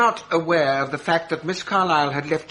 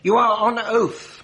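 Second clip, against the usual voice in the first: slow, pointed and raised, each word weighed; stern warning; 8 semitones higher, 4 dB louder, slower than usual. Acted. Barrister warns the lying witness on the stand, sternly and slowly. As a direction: press the witness hard with a slow rebuke.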